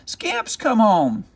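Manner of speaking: put-on very high, delighted boyish call; boy overjoyed to see his dog again; excited calling.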